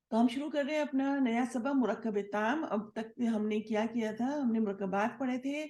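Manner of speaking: usual speaking voice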